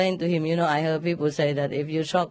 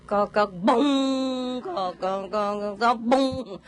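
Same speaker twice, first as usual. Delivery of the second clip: imitated temple knocks and gong; 3 semitones higher, much slower than usual. Nun imitating the temple's wooden fish and gong. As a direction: mimic the monks' drum and bell rhythm. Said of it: "And they go".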